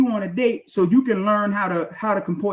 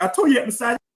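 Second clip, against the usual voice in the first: much higher mocking voice of an accuser; mocking impression; 5 semitones higher, much faster than usual. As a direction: parody the accuser, high and scornful. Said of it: "you see that?".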